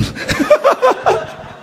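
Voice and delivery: high burst of laughter; big laugh. Real, not acted. Laughing at his own story.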